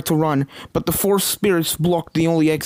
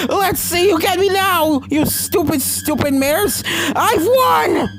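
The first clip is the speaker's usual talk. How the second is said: high, loud crazed triumph; manic gloating; 14 semitones higher, 4 dB louder, slower than usual. A narrator's reading. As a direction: crow over the mares, unhinged. Starlight gloats inside the suit.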